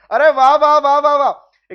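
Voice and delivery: loud, excited wah wah; delighted praise. Real, not acted. Teacher praising Khushi for her right answer, excited and loud. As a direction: cheer the student on.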